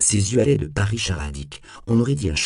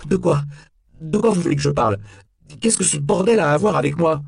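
high, loud desperate questions; exasperated, cornered outcry; frantic indignant protest; 10 semitones higher, 6 dB louder than usual. A narrator's reading. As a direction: demand answers at the top of the voice. Interrogated suspect protests his innocence.

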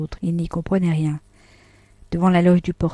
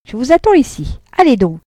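higher and louder command; 6 semitones higher, 6 dB louder than usual. A narrator's reading. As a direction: push him up the stairs. Vatnaz pousse Frédéric à monter. (ordre pressant, plus aigu et plus fort)

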